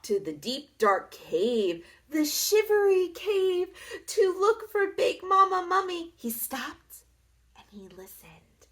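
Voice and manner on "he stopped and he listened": dropping to a near whisper; hushed. A narrator's reading. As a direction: hush for suspense. Suspense narration.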